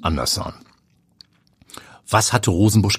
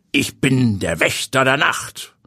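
eerie watchman voice; 6 semitones higher than usual. A narrator's reading. Hooded watchman introduces himself.

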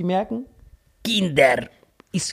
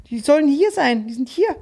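put-on higher little girl insisting; child's voice; 8 semitones higher than usual. Girl insists parents are here, high child voice. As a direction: insist like a small kid. Girl's voice in retold story.

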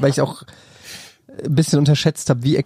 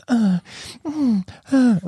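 mock moan; 8 semitones higher, much slower than usual. Imitating dubbing actor moaning.

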